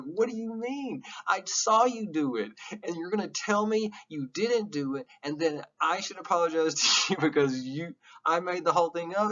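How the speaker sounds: high, incredulous voice; mock indignant victim. Teacher acting out a gaslighting victim, incredulous. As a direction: play the wronged friend in disbelief. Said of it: "It's like".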